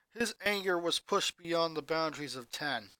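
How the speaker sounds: ordinary delivery, nothing marked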